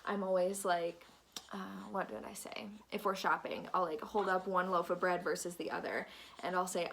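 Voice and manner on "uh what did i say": unvoiced whispered aside to herself; whisper to self. Real, not acted. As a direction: drop to a whisper, thinking aloud. Forgets mid-talk, whispers to self.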